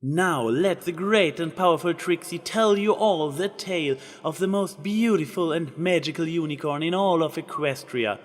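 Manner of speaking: voiced Trixie proclaiming grandly; grand proclamation. A narrator's reading. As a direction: announce it like a stage magician. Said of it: "Trixie proclaimed".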